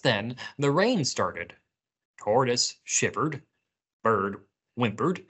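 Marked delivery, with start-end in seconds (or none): none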